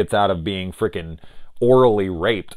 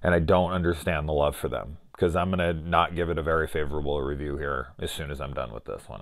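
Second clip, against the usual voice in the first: usual speaking voice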